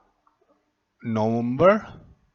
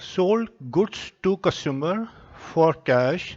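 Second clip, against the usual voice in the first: slow dictation; much slower than usual. Shown as a demonstration. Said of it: "dictating entry slowly".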